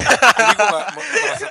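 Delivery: high laughing voice. Real, not acted. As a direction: laugh through the words. Says it through laughter. (sambil tertawa)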